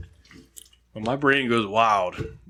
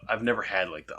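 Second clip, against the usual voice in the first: usual speaking voice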